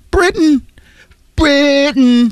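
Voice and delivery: high drawn-out call; high sing-song. Acted. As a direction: sing the word Britain. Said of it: "drawn-out sung word".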